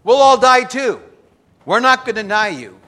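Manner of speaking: loud high-pitched promise from the disciples; disciples' vow. Acted. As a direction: shout it out like the whole group agreeing. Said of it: "all the disciples said the same thing".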